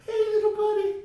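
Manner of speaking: put-on very high, tiny baby-talk voice; squeaky falsetto greeting, played for laughs; mock cooing. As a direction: coo as if coaxing a little pet. Imitating character cooing at alien creature.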